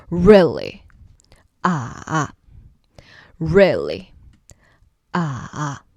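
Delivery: skeptical "Really?" dipping low then back; third tone dip. Shown as a demonstration. Teacher showing the dipping third tone as a skeptical really. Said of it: "the third tone is the dip. It's like a skeptical".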